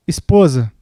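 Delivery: low, halting plea with long pause; remorseful apology. Acted. Husband phoning his wife to ask forgiveness, subdued remorse. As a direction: act out a subdued, repentant husband.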